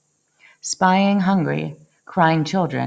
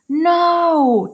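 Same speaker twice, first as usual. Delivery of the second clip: loud, much higher yell; 10 semitones higher, 4 dB louder than usual. A narrator's reading. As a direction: shout it at the wreckers. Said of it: "Tyree yelled".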